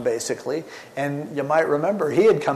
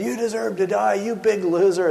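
mild mock yell; 7 semitones higher than usual. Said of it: "who had been yelling for his head".

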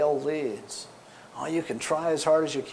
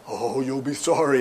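phony spooky voice. Retold or imitated. Caller threatening Skip on the phone, mock sinister. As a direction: imitate a put-on sinister caller. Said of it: "hiding behind this phony voice".

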